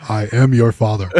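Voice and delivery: low, solemn booming declaration; God voice. Pretend. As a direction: lightly boom it down from the sky. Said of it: "Go ahead, God voice".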